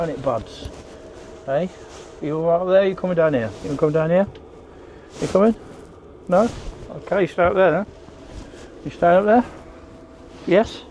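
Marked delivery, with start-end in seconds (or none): 1.5-10.8 shouting up scaffolding. Real, not acted. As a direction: call up to the dog above. Calling up to dog.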